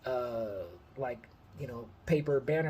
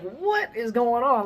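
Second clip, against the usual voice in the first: high, louder, slow amazed question; amazed disbelief; 8 semitones higher, 5 dB louder, slower than usual. Retold or imitated. Hearing Chris praised him to his stepdad, amazed. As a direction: spell it out in disbelief.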